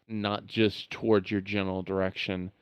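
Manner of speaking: usual speaking voice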